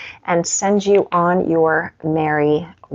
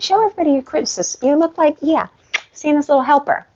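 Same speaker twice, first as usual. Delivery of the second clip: dog talk; 8 semitones higher than usual. Real, not acted. Praising dog in sweater.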